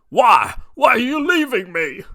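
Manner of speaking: high, loud hammed-up wail; a sobbing plea to the departing sludge; mock crying villain. Villain crying over the sewer grate, hammed-up wail. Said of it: "The villain starts crying".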